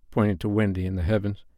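ordinary delivery, nothing marked

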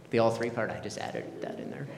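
breathy whispered admission; whispered aside. Real, not acted. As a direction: whisper it as a sly confession. Confessing his own joke aside.

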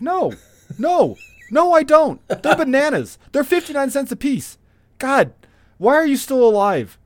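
high mock fury at a fussy shopper; exasperated retort. Exasperated retort to the old customer.

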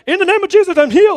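high, loud, hammed-up commanding prayer; mock bold prayer. Preacher mocks the perfect prayer he did not pray, hammed up. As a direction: ham up a showy deliverance prayer. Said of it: "perfect worded out prayer".

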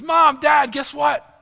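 shouted high and loud, bursting with news; a thrilled boy yelling for his parents; excited kid shout. Retold or imitated. Son bursting in to tell parents, excited shout. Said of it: "he's full of excitement".

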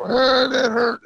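high, slow mock wail of a hurt kid; mock crying. Father imitating how a spanked child cries out. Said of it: "you just start crying and you go".

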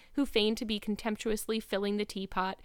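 ordinary delivery, nothing marked

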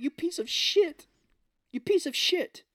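very high, loud mock insult; hammed-up high-pitched outrage; mock outraged heroine. Recapper voicing heroine cursing the traitor, mock outraged.

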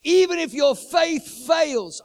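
a loud, slow echo of God's promise; booming repeat. Acted. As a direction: thunder the repeat of "even". God's word, repeated louder.

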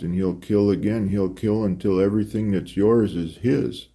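plain reading voice

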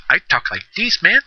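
high-pitched, hammed-up Mexican accent; fake Mexican accent. Shown as a demonstration. Podcaster mocking his online persona for listeners, in a fake Mexican accent. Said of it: "with the fake Mexican accent".

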